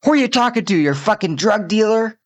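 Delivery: mock yell of an outraged mom; angry mother. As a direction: screech it like a furious mother. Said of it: "she was like".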